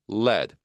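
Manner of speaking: "lead" as led, low, with forward L; L resonating in front. Shown as a demonstration. Teacher repeating the L example, forward L in "lead". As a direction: say "lead" rhyming with bed, L at the front. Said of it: "앞쪽에서 울리죠".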